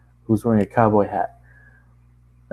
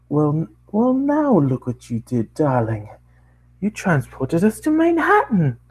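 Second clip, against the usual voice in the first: posh scolding pony; 7 semitones higher than usual. Acted.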